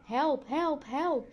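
repeated call, not a full shout; calling for help. A narrator's reading. Three friends locked in cinema, calling for help. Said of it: "We riepen zo hard als we konden".

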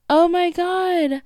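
high, drawn-out freak-out; imitating a freaked-out sister. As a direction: mimic her stunned, stretched-out disbelief. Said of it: "freaked out and she was like".

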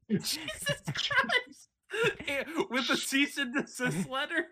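drawn-out laughing cry; laughing shock. Real, not acted. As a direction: squeal it through laughter.

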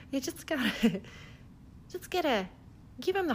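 put-on laughing through a Minnesota accent; giggly folksy Midwestern accent; Cheryl Lipinski accent. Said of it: "do it with that Cheryl Lipinski accent".

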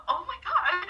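high, loud mimicked gasp of delight; little squeal. Bunch of friends reacting behind her, imitated little squeals. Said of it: "you heard a bunch of little".

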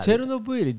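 Chernobyl pitched high, as "Cherunobuiri"; Japanese pronunciation. Shown as a demonstration. Co-host wondering where it comes from, high Japanese-style Chernobyl. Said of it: "no se utiliza el buiri".

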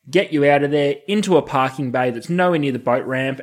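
usual speaking voice

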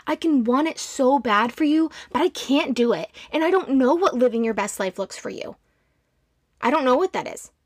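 ordinary delivery

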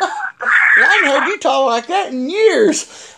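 high, drawn-out astonishment; imitated amazement. Partner amazed at his swearing, imitated high, slow disbelief.

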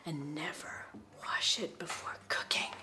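slow, hushed, spooky read; scary whisper. Acted. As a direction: whisper it like a horror trailer. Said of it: "I'm trying to make it sound scary".

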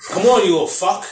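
retold taunt in own accent; mock taunt. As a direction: jab the insult out, playfully acted. Retold insult from movie character.

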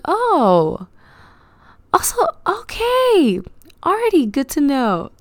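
surprised. Retold or imitated. Finds he talks normally on phone.

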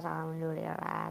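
softly grateful; murmured thanks, soft and calm; soothing voice. Real, not acted. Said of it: "Aduh, suaranya adem banget".

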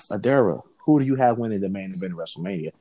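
ordinary talk

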